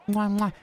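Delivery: two kisses blown high and bright; "Mwah, mwah" as playful smacking air kisses; double air kiss. Real, not acted.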